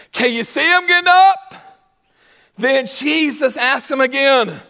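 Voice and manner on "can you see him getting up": higher and louder, "up" lifted; loud ringing question. Acted. Preacher calls out to the congregation, loud ringing question. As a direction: call it out to the whole room.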